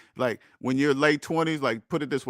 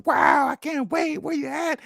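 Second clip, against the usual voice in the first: gushing commenter; 10 semitones higher, slower than usual. Retold or imitated. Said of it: "all the comments underneath".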